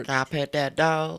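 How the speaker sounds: slow, drawn-out Southern accent imitation; mock a slow Southern drawl on a playful question. Joking with his co-host in a slow, mock Southern accent. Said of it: "do a Southern accent".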